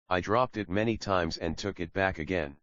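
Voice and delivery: plain reading voice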